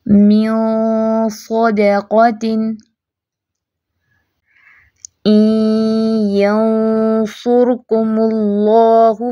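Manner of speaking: slow tajweed recitation, each ikhfa ghunna heavy; heavy ghunna examples. Shown as a demonstration. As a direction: recite every word slowly with thick ghunna. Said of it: "आपने महसूस किया इख्फा भी मोटा". (धीमी तिलावत, मोटा गुन्ना)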